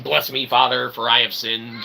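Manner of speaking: ordinary talk, nothing marked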